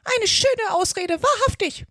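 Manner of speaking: high, loud scornful bird voice; deep contempt. A narrator's reading. Pigeon dismissing Alice's excuse, deeply contemptuous. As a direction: scoff slowly at a feeble excuse. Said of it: "im Tone tiefster Verachtung".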